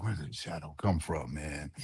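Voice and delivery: low, very soft retold aside; hushed puzzlement. Man alone in dark lobby hears his name, hushed puzzlement. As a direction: wonder under your breath, voice dropped.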